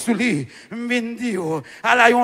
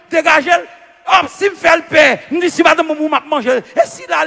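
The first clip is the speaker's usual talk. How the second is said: usual speaking voice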